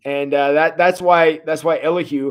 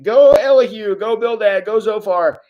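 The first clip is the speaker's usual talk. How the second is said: mock cheering; 6 semitones higher than usual. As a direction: cheer like a fan in the stands. Fan cheering with banner.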